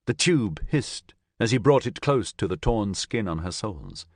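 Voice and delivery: ordinary delivery, nothing marked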